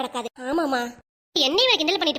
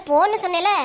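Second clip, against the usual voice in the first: raised, higher-pitched scolding; scolding shout; 3 semitones higher, 5 dB louder than usual. Acted. Mother ordering her pestering child away, scolding shout. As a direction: snap at the child to go. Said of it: "போறேன், கத்தாதீங்க".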